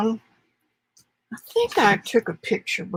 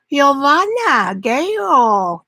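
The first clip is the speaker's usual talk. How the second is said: slow, drawn-out Italian name in an Arkansas accent; 4 semitones higher, 3 dB louder, much slower than usual. Shown as a demonstration. Painter showing viewers her Arkansas Italian accent on the name. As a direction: stretch out Giovanna the Arkansas Italian way. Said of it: "That's my Arkansas Italian accent".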